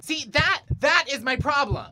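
high, loud, frantic ranting; manic rant. Acted. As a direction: rant like a man seeing the truth. Manic revelation while tripping.

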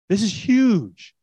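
slow, loud stress on huge; emphatic. Real, not acted. Preacher stressing to congregation that this is huge, slow and loud. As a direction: lean hard on huge, slowly.

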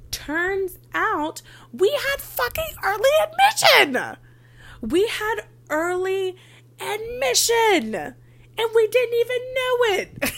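high, loud and drawn out in disbelief; exasperated outburst. Real, not acted. Telling viewers she missed early admission, slow loud exasperated wail. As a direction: wail it slowly like you can't believe your own mistake.

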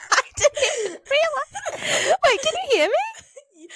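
high, slow words through giggles; can barely get it out for laughing. Real, not acted. Laughing at her own mic mistake.

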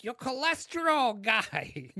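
high, loud, drawn-out mobster voice; mob doctor voice. Retold or imitated. Doctor breaks the cholesterol news, loud mock-mobster voice. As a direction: stretch it like a mock wiseguy. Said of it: "he would do, like, voices".

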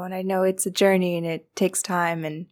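normal talking voice

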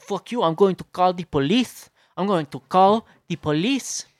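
high, loud caricature of outrage; indignant threat. Retold or imitated. Driveway intruder threatening the friend with police, indignant.

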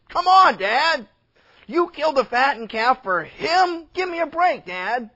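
high, loud protest to Dad; loud, pleading exasperation; exasperated son. Acted. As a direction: burst out in acted indignation. Son's acted protest to his father.

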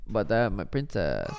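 ordinary delivery

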